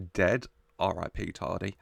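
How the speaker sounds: normal talking voice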